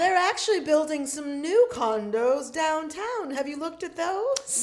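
high, loud, drawn-out mock-British voice; put-on British accent. Well-off relative suggesting downtown condos, in mock-British accent. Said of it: "where is that accent coming from".

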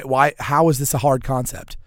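normal talking voice

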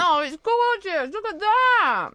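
high-pitched adoring coo; gushing admiration. Real, not acted. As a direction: gush over something gorgeous. Admiring the dog photo.

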